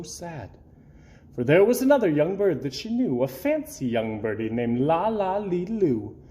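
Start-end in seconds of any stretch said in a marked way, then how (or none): none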